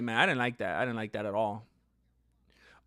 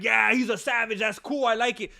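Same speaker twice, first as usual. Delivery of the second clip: an impressed fan, a bit louder and higher; mock fan hype; 6 semitones higher, 6 dB louder than usual. Fan in the comments cheering the finish, mock hype. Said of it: "some of you could be acting like".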